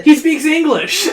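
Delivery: high, loud, slow cry of amazement; amazed exclamation. A narrator's reading. Companion hears the cave king talk, amazed cry. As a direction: exclaim it high, on the edge of laughing.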